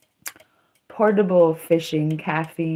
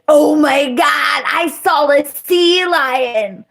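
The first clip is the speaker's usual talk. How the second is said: higher and louder, slow and thrilled; excited; 7 semitones higher, 6 dB louder, slower than usual. Real, not acted. Telling viewers about seeing a sea lion, excited and slow. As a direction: gush about it like it made your day.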